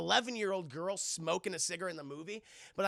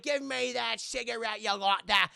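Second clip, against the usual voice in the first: mock Cockney teacher; 4 semitones higher, 5 dB louder than usual. Teacher demanding cigarette.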